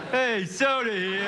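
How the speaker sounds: derelict vendor call. Retold or imitated. College kid at a vendor audition breaking into a slow vendor call. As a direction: hawk it like a stadium lifer. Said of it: "My name is [name]".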